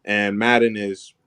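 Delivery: ordinary delivery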